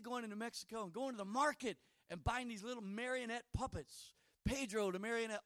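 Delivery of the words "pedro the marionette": usual speaking voice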